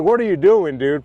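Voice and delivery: high, loud disbelief; what are you doing, shouted up high; baffled shout at the pushy guy; incredulous yell. Retold or imitated. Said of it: "I'm like".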